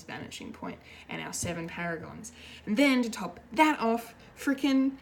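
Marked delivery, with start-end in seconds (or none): 2.7-4.9 high and loud, revving up; can't contain the fan excitement; worked-up excitement. Real, not acted. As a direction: fan ramping up to a big reveal, high and loud. Excited recap of the episode.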